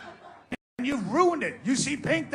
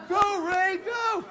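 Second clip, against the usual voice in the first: high shouted cheer, rising on the last go; mock cheering crowd; 8 semitones higher, slower than usual. Crowd cheering a charity runner, high excited shout. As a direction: cheer him on like a fan at the race. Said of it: "everyone's gonna go".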